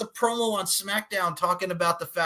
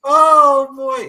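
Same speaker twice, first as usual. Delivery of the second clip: excited exclaim; 11 semitones higher, 11 dB louder than usual. Real, not acted. Reacting with delight.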